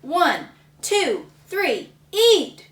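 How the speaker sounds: judge yelling. Acted. Judge starting the pie contest, loud countdown. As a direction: call the start like a referee. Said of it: "The judge yelled".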